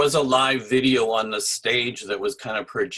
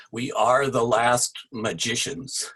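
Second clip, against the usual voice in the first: raised, declaiming voice for a play excerpt; dramatic reading; 4 semitones higher, 4 dB louder than usual. Acted. Puppeteer reading his friend's play excerpt aloud, declamatory. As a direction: proclaim it like a stage line to the room.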